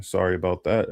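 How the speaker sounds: ordinary talk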